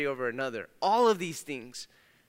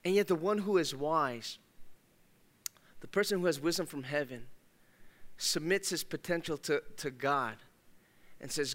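ordinary talk, nothing marked

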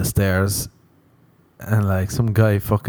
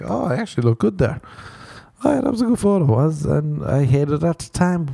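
high surprised gush; 8 semitones higher than usual. Retold or imitated. Man rediscovering an old photo, high surprised gush.

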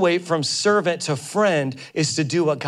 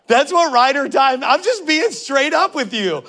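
laughing excitement; 8 semitones higher, 5 dB louder than usual. Real, not acted. Laughing, hyped explanation.

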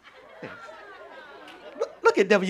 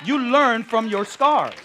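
louder, stressed on "learn"; louder repeat; 6 semitones higher, 8 dB louder, slower than usual. Shown as a demonstration. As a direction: push it so they clap. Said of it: "anybody back there gonna clap".

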